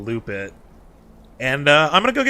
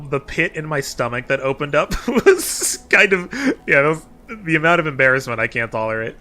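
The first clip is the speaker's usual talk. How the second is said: normal talking voice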